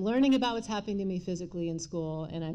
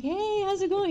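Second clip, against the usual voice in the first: bright, much higher mock-casual hello; sing-song neighborly hi, pitched way up; fake cheerful greeting; 13 semitones higher, 5 dB louder than usual. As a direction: pretend nothing happened all night. Fake cheer after night fighting.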